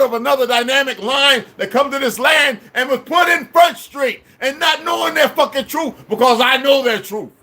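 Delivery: real heated harangue; angry tirade. Real, not acted. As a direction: berate them relentlessly in a high shout. Long tirade berating listeners.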